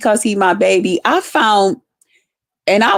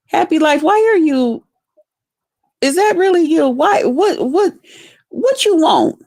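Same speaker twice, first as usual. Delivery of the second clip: a high, suspicious call to the guy; calling out, high and wary, to a stranger; calling out to him; 6 semitones higher than usual. Acted. Addressing bottle collector on stream.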